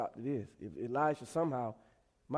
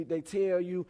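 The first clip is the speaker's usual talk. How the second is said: ordinary talk, nothing marked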